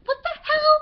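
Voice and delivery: very high, loud squeal of disbelief; outraged fan disbelief. Real, not acted. Fan recalling what happens with Nick, shrill disbelief. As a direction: squeal it at the plot twist. Fan reacting to plot twist.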